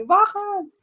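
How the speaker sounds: high, far-carrying call; mock combi call to get off; calling the stop. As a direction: shout it toward the driver. Passenger calls stop in combi.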